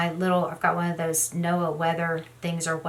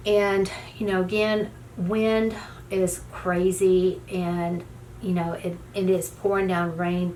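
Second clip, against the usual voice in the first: normal talking voice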